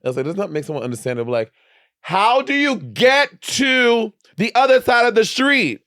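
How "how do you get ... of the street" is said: loud, high, drawn-out tourist shouting; slow every word right down and shout it; over-loud, stretched-out question for foreigners; loud English-speaker abroad. Acted. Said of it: "are just speaking English loud".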